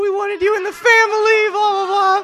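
very high, loud imitation of tearful wailing; mock crying. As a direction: wail it like a hammed-up weeping family. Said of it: "they're crying".

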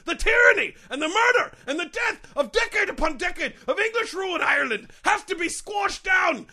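loud, high ranting outrage; impassioned rant. Acted. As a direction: rise into a furious tirade. Mascot's joke turns into rant.

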